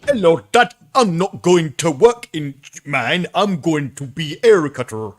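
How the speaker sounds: high, loud mock Yorkshire accent; bad Yorkshire accent. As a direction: ham up a terrible Yorkshire accent. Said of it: "Josh Hartnett doing a Yorkshire accent".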